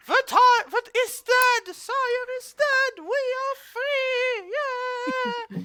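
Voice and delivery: high, strained dwarves' cheer held back in volume; mock yelling. Freed dwarves yelling that their master is dead, mock yell. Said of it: "they're yelling".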